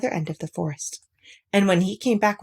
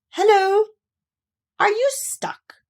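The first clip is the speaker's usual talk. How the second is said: Rabbit's voice; 14 semitones higher, 4 dB louder than usual. Acted. Rabbit greets stuck Pooh.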